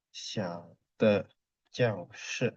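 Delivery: xiao de jiao shi, syllable by syllable; reading demonstration. Shown as a demonstration. Teacher reading Mandarin to class, xiao de jiao shi spaced out. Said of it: "Ini cara bacanya".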